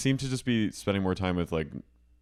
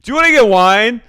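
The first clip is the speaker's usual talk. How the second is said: high, loud, boozy sing-song; drunk Miranda; 14 semitones higher, 17 dB louder, faster than usual. Retold or imitated. Miranda urging friends to split a bottle, loud boozy voice. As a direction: push the whole bottle on everyone with a big tipsy voice. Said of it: "They say".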